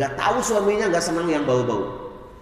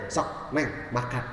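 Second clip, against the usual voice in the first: softer, lower, tender coaxing; gentle invitation; 6 semitones lower, 9 dB quieter, slower than usual. Retold or imitated. Husband softly inviting his wife to eat, tender. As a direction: coax her kindly to eat.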